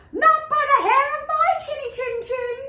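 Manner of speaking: high, fast little pig voice; a frightened little pig squeaking no; high squeaky pig. Acted. First pig refuses the wolf at the door, high and fast.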